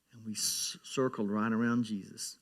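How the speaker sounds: ordinary delivery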